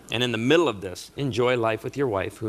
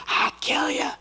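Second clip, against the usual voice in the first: very high squeaky mock threat. Father pretending to threaten the groom. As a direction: tease the groom.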